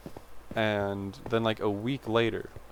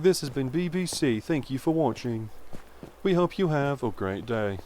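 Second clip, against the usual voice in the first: higher mock British TV announcer accent signing off; 4 semitones higher than usual. Fond British accent copying BBC announcers for viewers. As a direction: imitate a British broadcast sign-off. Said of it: "the British announcers".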